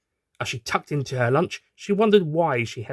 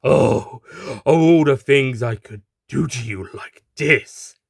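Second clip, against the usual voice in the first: loud, slow, menacing villain; a sinister threat to the captive; dastardly thug; 6 dB louder, slower than usual. Acted. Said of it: "a dastardly grin".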